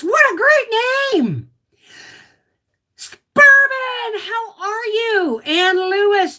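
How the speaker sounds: high, loud, drawn-out hello; effusive greeting. Real, not acted. Streamer greeting viewers by name, effusively. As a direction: sing out the names in delight.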